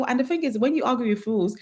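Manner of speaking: ordinary talk, nothing marked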